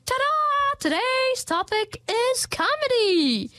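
high, bright ta-da reveal; excited announcement. Real, not acted. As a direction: announce it like a game-show host. Grand reveal of the topic.

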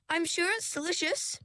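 polite, firm refusal pitched high. A narrator's reading. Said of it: "said Ringlet in a firm voice".

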